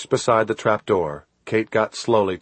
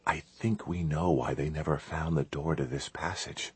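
hushed, softer and a little lower; whispered; 3 semitones lower, 12 dB quieter than usual. A narrator's reading. Chris whispering to Kate by the trap door, hushed. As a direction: keep it very quiet, as if hiding. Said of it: "He whispered".

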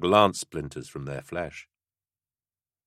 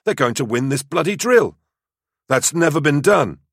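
high, raised voice of outraged disbelief; indignant exclaiming; 10 semitones higher, 5 dB louder than usual. A narrator's reading. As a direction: protest loudly, pitch pushed up. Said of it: "Cylon exclaimed".

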